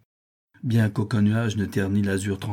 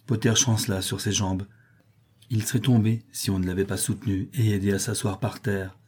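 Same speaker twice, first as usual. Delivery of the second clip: ordinary delivery, nothing marked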